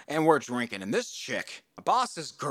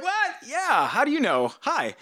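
retold surprise; 11 semitones higher, 3 dB louder than usual. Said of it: "I was like".

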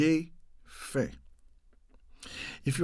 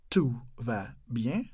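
"Tout va bien" slowly and softly, a pause between words; 5 semitones lower, 5 dB quieter, slower than usual. Shown as a demonstration. Teacher asking it slowly for learners to copy. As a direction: separate "Tout", "va", "bien", rising on the last.

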